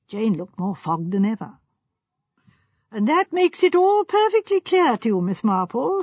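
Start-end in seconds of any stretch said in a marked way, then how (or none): 2.9-6.0 high, louder, gently teasing; with a twinkle. A narrator's reading. Sir Henry teasing Miss Marple, high and amused. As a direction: tease her with a twinkle in the voice. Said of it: "said Sir Henry with a twinkle".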